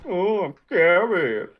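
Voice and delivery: slow, high sarcastic fear; mock scared. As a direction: pretend to tremble, slowly.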